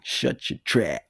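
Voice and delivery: high-pitched imitated Southern accent; Mister King. Teacher scolding a late, talking pupil, mock Southern accent. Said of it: "he had his accent".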